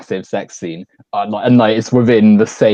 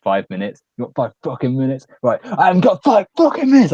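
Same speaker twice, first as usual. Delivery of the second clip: sudden mock escalation; 4 semitones higher than usual. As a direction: imitate a dealer blowing up from zero. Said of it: "goes from zero to, like, complete escalation".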